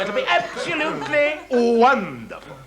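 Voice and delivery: high, laughing relish; gleeful enthusiasm. Acted. Director delighted by the comic relief, gleeful.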